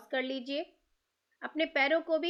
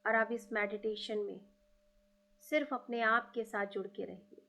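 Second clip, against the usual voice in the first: guided meditation; 2 semitones lower than usual. Acted. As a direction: lead listeners gently inward. Guided meditation invoking gurus.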